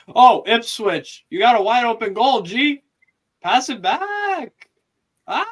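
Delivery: excited yelling. Real, not acted. Watching a chance at goal.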